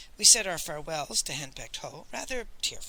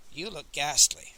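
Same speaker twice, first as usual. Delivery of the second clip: ordinary delivery, nothing marked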